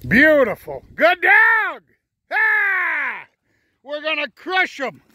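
high, loud cheering; excited praise. Real, not acted. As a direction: cheer the dog on like a fan. Dog did well, owner cheers.

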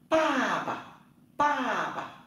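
pa pa said high with a rising second syllable; Chinese tone drill. Shown as a demonstration. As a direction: sing out pa pa high and clear for the class. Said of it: "Bố".

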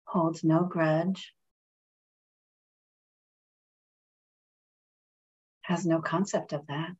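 calm, measured meditation voice with long pauses; guided meditation. Real, not acted. Reverend leading friends in a breathing meditation, calm and unhurried.

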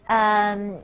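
ordinary talk, nothing marked

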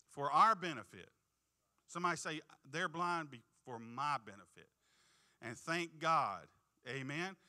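preacher calls out, rising; fervent preaching. Real, not acted. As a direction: drive the point home to the church. Preaching climax with call.

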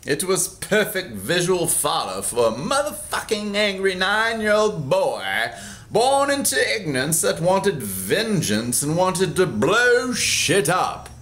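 plain reading voice

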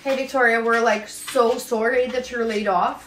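low, apologetic Canadian boss; polite Canadian manager. Acted. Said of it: "Canadians are so polite".

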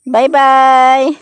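loud, drawn-out bye-bye; calling out goodbye. Real, not acted. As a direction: sing out a farewell across a distance.

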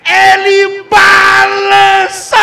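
loud, high play-by-play; shouted commentary. Real, not acted. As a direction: call the play at full volume. Excited play call after goal.

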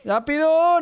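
sudden high urgent shout; panicking gamer. Real, not acted. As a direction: yell it in a rush. Racing the clock in game.

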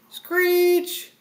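brakes screeching, voiced loud and high. A narrator's reading. Fred slamming the van to a stop, screeching. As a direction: imitate squealing tyres. Sound effect acted by the reader.

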